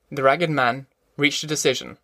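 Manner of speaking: ordinary delivery, nothing marked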